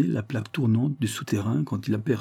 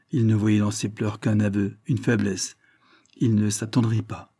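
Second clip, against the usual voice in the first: ordinary delivery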